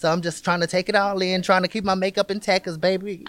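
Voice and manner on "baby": normal talking voice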